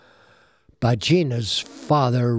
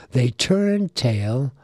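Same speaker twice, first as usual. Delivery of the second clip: ordinary delivery, nothing marked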